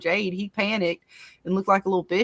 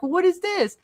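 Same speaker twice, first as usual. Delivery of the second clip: much higher, baffled squeak; mock-confused Jade; imitating Jade; 10 semitones higher than usual. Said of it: "He's like".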